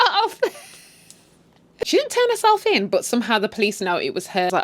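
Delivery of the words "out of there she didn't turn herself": breaking into laughter mid-sentence; real amused laugh cutting through the words. Real, not acted. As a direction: crack up while telling it. Cracks up at the character fleeing.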